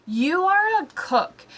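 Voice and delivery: ordinary delivery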